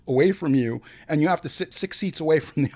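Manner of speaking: ordinary talk, nothing marked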